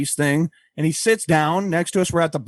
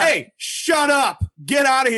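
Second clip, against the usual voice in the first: mock yell; 10 semitones higher than usual. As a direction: pretend furious shout at a priest. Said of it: "be like".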